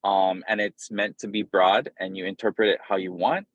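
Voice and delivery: usual speaking voice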